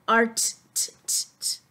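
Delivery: "t, t" voiceless repeated; letter sound t. Shown as a demonstration. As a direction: tap out "t" as puffs. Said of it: "the T says t".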